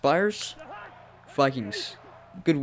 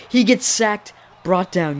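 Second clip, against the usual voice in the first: excited sack call; 6 semitones higher, 6 dB louder, slower than usual. Real, not acted. Calling a sack live.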